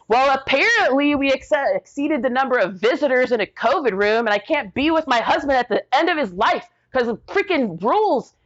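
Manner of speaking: high-pitched, mock-reasonable complaint; indignant sarcasm. Complaining to head nurse about rules.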